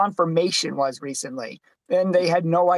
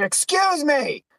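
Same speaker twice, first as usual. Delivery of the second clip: "Excuse me" called out much higher and slow; retold yell; 10 semitones higher, much slower than usual. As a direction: stretch "Excuse me" out high, like calling to a man across the street. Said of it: "So I yelled at him".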